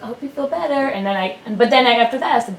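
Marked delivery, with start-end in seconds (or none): 0.0-0.9 put-on higher, a touch childlike; lightly imitated little son. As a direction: lightly mimic a child offering comfort. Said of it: "He's like".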